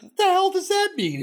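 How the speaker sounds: high, loud incredulous yelp; incredulous outburst. Real, not acted. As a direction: react in loud disbelief. Disputing "doesn't count" claim.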